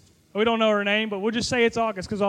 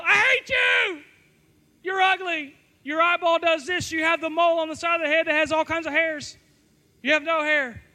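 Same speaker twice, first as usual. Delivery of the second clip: high shout easing into raised-pitch ranting; mock yelling; 5 semitones higher than usual. Insecure student hurls insults at a rival, mock yell settling into a rant. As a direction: open on a big yell, then rattle off petty insults. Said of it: "And then you yell".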